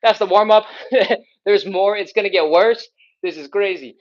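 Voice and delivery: hammed-up surprise at the workout; mock disbelief. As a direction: act out a stunned newcomer, half laughing. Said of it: "you're like".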